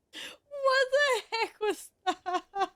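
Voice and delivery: high, slow words squeezed out through laughter; laughing so hard the words barely come; crying with laughter. Real, not acted. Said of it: "I'm crying".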